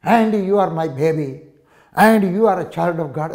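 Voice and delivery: very slow, stately English recital; grandly drawn-out love letter; ornate letter reading. Retold or imitated. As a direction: savour each word like a flowery letter. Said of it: "നല്ല അലങ്കാര ഭാഷയിൽ".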